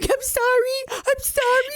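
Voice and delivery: very high-pitched tearful mimicry; imitated crying. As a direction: mimic a friend crying out an apology. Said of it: "I just imagine you crying".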